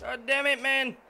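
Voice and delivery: loud, high burst of real frustration; frustrated outburst. Real, not acted. Gamer yelling at his game over a failed repair, angry outburst. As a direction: blow up at the game.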